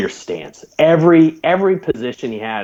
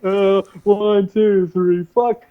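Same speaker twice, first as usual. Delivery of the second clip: higher, straining count; imitated exertion; 8 semitones higher, slower than usual. Trainee doing punishment flutter kicks, acted strained counting. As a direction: count as if struggling through exercise. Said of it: "Fucking doing flutter kicks".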